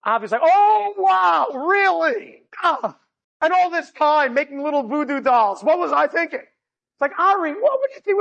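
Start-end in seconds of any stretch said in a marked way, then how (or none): 0.4-6.4 high, sarcastic gush of wonder; mock amazement. Brother's mocking reply, acted. 7.2-8.2 exasperated brother. Retold or imitated. Acted exasperated brother on phone.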